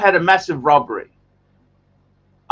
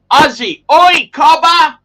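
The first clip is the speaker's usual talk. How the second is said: loud, high Aussie holler; broad Aussie accent; 12 semitones higher, 10 dB louder, slower than usual. Shown as a demonstration. Streamer shows off the Australian accent to viewers, loud broad Aussie. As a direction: call out in exaggerated Australian, drawn out. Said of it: "The Australian accent".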